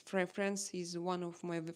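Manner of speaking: ordinary delivery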